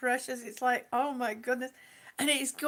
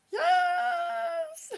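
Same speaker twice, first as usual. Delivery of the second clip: very high, stretched-out yes; sustained high-pitched cheer; thrilled squeal. Real, not acted. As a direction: squeal it with joy. Thrilled at baby news.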